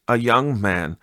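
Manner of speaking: ordinary delivery, nothing marked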